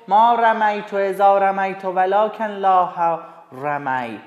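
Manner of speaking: ordinary delivery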